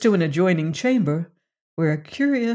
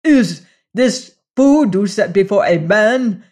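angry cry; 4 semitones higher, 7 dB louder than usual. A narrator's reading. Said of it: "he cried".

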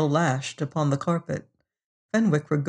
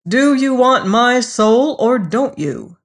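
slow, louder, higher-pitched demand; 7 semitones higher, 5 dB louder than usual. A narrator's reading. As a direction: press impatiently for a straight answer. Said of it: "James Fenwick demanded".

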